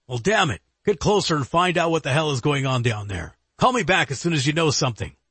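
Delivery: impatient orders, quick at the end; irritated boss. Acted. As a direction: snap out orders to a subordinate. Director orders agent.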